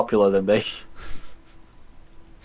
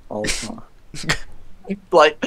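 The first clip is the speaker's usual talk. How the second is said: usual speaking voice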